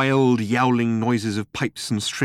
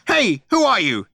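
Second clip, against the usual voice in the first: loud, high quacking demand; 12 semitones higher, 6 dB louder than usual. A narrator's reading. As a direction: quack it like an angry penguin. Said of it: "he quacked".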